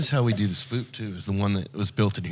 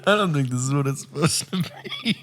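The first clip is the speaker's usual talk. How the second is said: higher, laughing repeat of her words; high mocking echo; 6 semitones higher than usual. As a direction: echo it back higher, giggling. Echoes the line mockingly.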